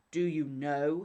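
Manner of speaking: loud, strange giant spirit's cry; a huge spirit threatening the scholar with a loud cry; awful spirit voice. A narrator's reading. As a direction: boom it out like a terrible giant. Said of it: "he cried in an awful voice".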